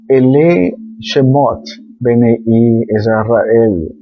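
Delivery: slowly intoned Hebrew words; slow Hebrew reading. Shown as a demonstration. Teacher slowly reading the first Hebrew words. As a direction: read out each Hebrew word slowly.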